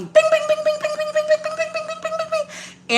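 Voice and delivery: very high rapid-fire bings; ping like a phone flooded with alerts, fading off; imitated notification pings.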